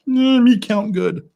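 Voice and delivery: high, slow, mock simpleton voice; dim-witted caveman. Owning up to bad counting, mock dim-witted. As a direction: play dumb, loud and drawn out.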